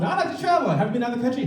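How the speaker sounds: high, lilting questioner voice; mock asker. Someone quizzing him about travel, voiced high and chirpy. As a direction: put on a chirpy inquiring voice. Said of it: "Every so often".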